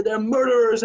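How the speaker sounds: high, loud accusing cry; angry shout. Acted. Husband telling his wife, high furious shout. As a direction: spit the word out at her.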